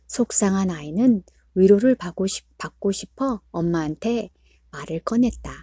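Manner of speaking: usual speaking voice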